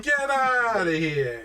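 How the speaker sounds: put-on Brooklyn accent. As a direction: do a hammed-up Brooklyn accent, stretching the words. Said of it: "a slightly Brooklyn accent".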